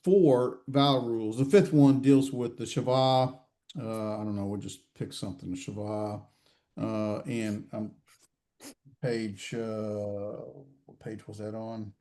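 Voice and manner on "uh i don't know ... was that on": low, soft talk to oneself; searching aloud, voice dropped well down; thinking aloud. Real, not acted. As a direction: quietly puzzle it out to yourself. Thinking aloud while hunting for page.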